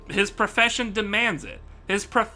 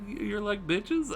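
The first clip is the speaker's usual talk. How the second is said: much softer, slow, unsure rising jab; soft timid insult; 9 dB quieter, slower than usual. Retold or imitated. Streamer softly scolding his chat, much quieter and slow. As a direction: mumble the insult like you don't mean it. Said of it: "He's very soft".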